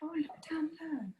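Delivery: whispered aside; whispering. Real, not acted. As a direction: breathe it out as an aside. Aside under the group chat.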